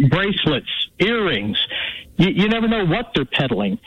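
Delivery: ordinary talk, nothing marked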